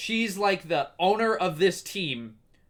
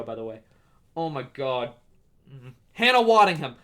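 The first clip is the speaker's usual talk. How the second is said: normal talking voice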